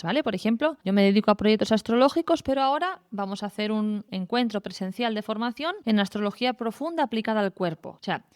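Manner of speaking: normal talking voice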